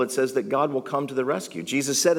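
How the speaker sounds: ordinary delivery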